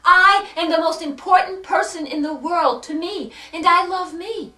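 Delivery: loud, forceful declaration, short of screaming; retold scream. Grieving daughter retelling her cry after the cemetery, mock scream. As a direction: proclaim it loudly, as a retold outcry. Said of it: "on the top of my lungs, I screamed out".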